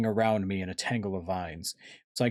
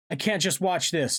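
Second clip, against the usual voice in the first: high, urgent outburst; yelled; 9 semitones higher, 4 dB louder than usual. A narrator's reading. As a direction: cry out, unable to stand by. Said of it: "Tobias yelled".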